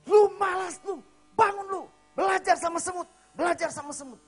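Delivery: high, loud scolding shout; quarrelling wife. Retold or imitated. Wife scolding her lazy husband, angry shout. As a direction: yell it like a wife at the end of her patience. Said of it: "kalau marahan".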